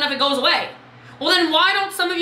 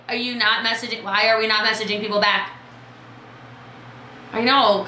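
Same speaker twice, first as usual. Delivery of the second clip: ordinary delivery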